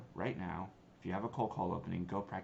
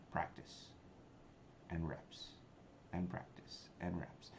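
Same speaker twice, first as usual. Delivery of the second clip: hushed mantra; 2 semitones lower, 9 dB quieter, slower than usual. Shown as a demonstration. Quiet emphatic mantra.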